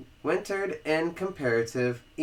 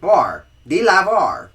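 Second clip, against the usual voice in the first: loud; 6 semitones higher, 10 dB louder than usual. Shown as a demonstration. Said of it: "pronunciation drill".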